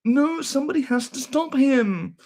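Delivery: very high, drawn-out wail; hammed-up outraged cry, loud and high; mock raging hater. Streamer mimicking raging haters calling for mother, high wailing. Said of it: "they just get raging".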